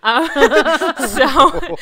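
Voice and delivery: loud laughter breaking through the words; real laugh over uh, so. Real, not acted. Laughing at own ex joke.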